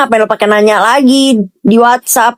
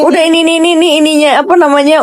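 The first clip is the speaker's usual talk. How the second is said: singsong excitement; 6 semitones higher, faster than usual. Real, not acted.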